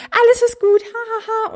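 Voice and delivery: high, loud hammed-up parent soothing a child; mock cheery parent. Mocking an American TV family, hammed-up cheerful parent voice. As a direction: parody a too-cheerful parent, shrill laugh. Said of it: "die meinte so".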